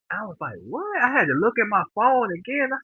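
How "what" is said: high-pitched, soft startled question; startled disbelief. Retold or imitated. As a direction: reenact a jolt of disbelief, pitch leaping up. Waking late, shocked at the time.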